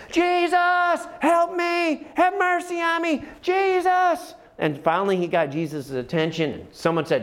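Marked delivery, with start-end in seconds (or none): 0.1-4.3 very high, loud desperate plea; even louder cry. Retold or imitated. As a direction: cry out to Jesus with everything. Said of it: "he got even louder".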